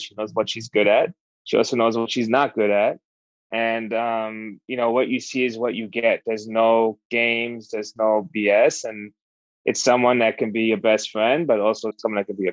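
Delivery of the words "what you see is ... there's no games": usual speaking voice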